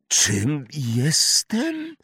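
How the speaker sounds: breathy stunned question; breathless. Acted. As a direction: gasp it out. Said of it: "wydyszał Harry".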